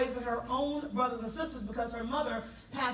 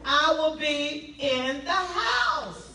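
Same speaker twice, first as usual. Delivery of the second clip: emphatic declaration; slower than usual. Retold or imitated. Speaker vows to her audience to show up, emphatic declaration. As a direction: declare it big, stretching the last words.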